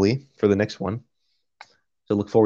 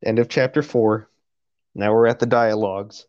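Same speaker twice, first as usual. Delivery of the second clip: normal talking voice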